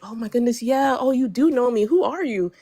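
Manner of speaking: excited friend realizing they know each other; retold excitement. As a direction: play her sudden thrilled recognition. Retold friend recognizing her.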